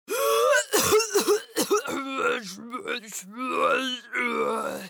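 spluttering cough trailing into a long grumble; grumpy creature coughing and muttering wordlessly; cough, then grumble it out; coughing and grumbling. A narrator's reading. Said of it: "He was coughing and grumbling".

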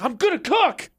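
high, hammed-up protest to dad; lightly played pleading son; defiant son. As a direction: insist on it like a thwarted kid. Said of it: "I can't do an Italian accent".

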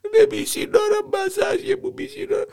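high tearful wail. Acted.

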